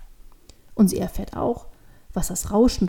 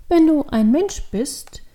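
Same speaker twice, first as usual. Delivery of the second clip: put-on higher, slow child voice; questioning child; 5 semitones higher, 4 dB louder, slower than usual. A narrator's reading. Devil girl asks about Santa, slow and wondering. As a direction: lead slowly into a child's question.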